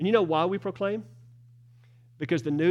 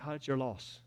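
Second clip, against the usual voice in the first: much softer, tossed-off it's your loss; dropped, offhand loss; 5 semitones lower, 11 dB quieter than usual. Shown as a demonstration. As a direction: let your loss fall away quietly. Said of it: "And if you reject it".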